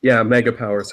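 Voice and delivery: ordinary talk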